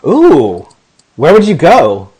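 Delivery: ordinary talk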